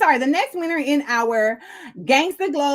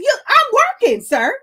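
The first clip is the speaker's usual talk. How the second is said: indignant; 9 semitones higher, 4 dB louder than usual. Retold or imitated. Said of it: "I'm like".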